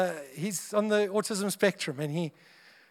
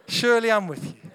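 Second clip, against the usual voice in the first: ordinary talk, nothing marked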